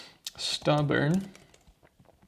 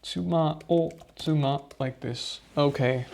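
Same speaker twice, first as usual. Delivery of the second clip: usual speaking voice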